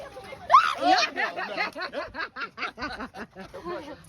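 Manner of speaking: long run of laughter; real laughter. Real, not acted. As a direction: burst out, then keep chuckling. Laughing at the obstacle attempt.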